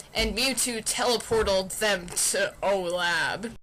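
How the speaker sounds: usual speaking voice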